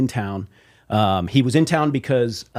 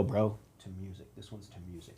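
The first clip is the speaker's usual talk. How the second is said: much softer, lower murmured toast; quiet toast; 5 semitones lower, 16 dB quieter than usual. Retold or imitated. Two bandmates at the bar, a soft low toast. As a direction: toast under your breath at the bar. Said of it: "and we go".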